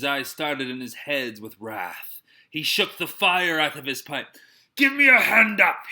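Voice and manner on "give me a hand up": loud, high cry. A narrator's reading. Silver crying out for a hand up, loud. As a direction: call out for help up. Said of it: "he cried".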